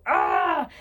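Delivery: loud high frustrated yell; furious frustrated scream; angry growl. Acted. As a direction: let it rip in frustration. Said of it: "let out an angry growl".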